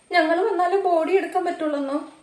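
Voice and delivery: a mother sobbing in disbelief at the news on the phone; breaking into sobs. Acted. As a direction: let the news break you into crying. Mother hears of daughter's death.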